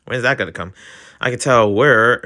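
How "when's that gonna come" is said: normal talking voice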